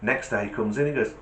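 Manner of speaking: ordinary delivery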